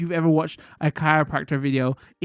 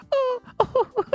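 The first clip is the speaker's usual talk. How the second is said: much higher laughing oh, oh; high embarrassed squeal. Real, not acted. Embarrassed reaction.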